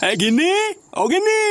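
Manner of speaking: high call across the house. Acted. Husband calling his wife from another room, high call.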